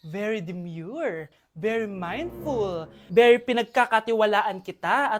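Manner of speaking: slow, low, drawn-out "very demure"; slow demure delivery. Acted. Mother telling her child to come home early, slow and prim.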